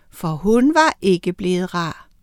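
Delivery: plain reading voice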